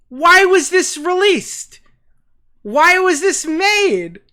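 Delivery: high, loud, slow and disbelieving; exasperated disbelief. Real, not acted. Streamer reacting to the leaked call, loud and slow in disbelief. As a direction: drag each question out in outrage.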